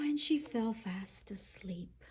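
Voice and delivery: hushed whisper, as if not to wake her. A narrator's reading. Storyteller to children as Goldilocks sleeps, soft whisper. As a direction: whisper the line so she stays asleep.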